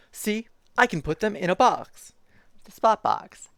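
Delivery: lively picture-book reading voice; reading to children. Acted. Streamer reading a zoo picture book to chat, bouncy storytime voice. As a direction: read it like storytime for little kids.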